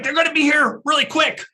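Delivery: high-pitched urgent crew voice; alarmed crew member calling out; alarmed warning. Acted. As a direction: warn them in a high, panicky voice.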